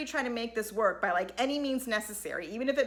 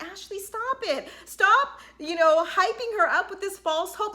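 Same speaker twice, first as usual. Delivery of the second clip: higher, slow, then louder stop; exasperated scolding; 7 semitones higher, 4 dB louder than usual. Retold or imitated. Speaker telling Ashley to stop hyping Whitney, exasperated. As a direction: scold her for the false hope.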